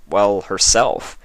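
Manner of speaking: ordinary delivery, nothing marked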